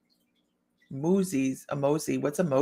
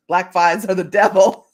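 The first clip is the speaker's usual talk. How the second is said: loud rant; 9 dB louder than usual. Real, not acted.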